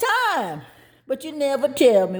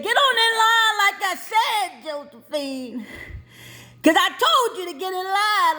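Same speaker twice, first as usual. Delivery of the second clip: sharp high teacher yell; 6 semitones higher than usual. Retold or imitated. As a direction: bark at the kid like an angry teacher. Teacher yelling at child in line.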